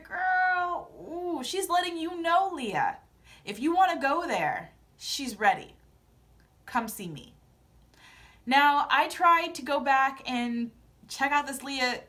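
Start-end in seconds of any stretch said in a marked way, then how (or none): none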